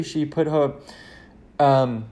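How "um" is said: normal talking voice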